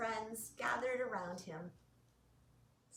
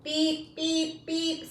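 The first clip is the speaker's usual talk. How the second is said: louder beeping horn; truck horn beep; 4 semitones higher, 7 dB louder, much slower than usual. Acted. Reading Little Blue's horn to kids, beeping. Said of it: "said Little Blue".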